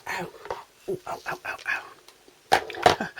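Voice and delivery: pained little ows, half whispered. Real, not acted. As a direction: wince through each ow. Hurts her arm reaching up.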